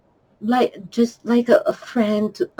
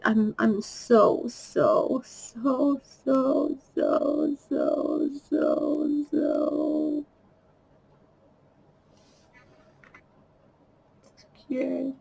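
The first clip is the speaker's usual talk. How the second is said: breaking down; 4 semitones higher, 7 dB quieter, much slower than usual. Real, not acted. Breaking down over her loneliness.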